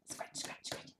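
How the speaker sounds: whispered scratchy "scratch" noise; scratching sounds. Pretend. Acting out scratching for a toddler, quick raspy whisper. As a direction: make the word sound like claws scraping. Acting out the book's action word.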